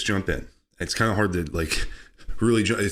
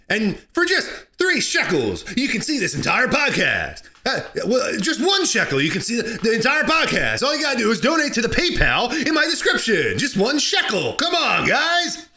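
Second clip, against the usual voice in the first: high-pitched wheedling pitch; mock sales plea; 9 semitones higher than usual. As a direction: beg for shekels in a raised, hammed-up voice. Hammed-up sales plea for shekels.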